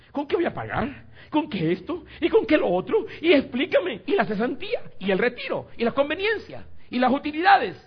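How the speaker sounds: very high, loud barrage of objections; hammed-up frantic worrier, firing off complaints; mock panicked questioning. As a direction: imitate someone panicking over money, almost shrieking. Acts out escalating doubts about leaving job.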